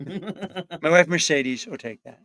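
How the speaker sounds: laughing. Pretend. Impression, laughing.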